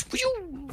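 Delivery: very high airy whoosh; character dodge sound. Retold or imitated. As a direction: squeak it out like the dodging character. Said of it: "She goes".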